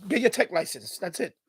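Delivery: ordinary talk, nothing marked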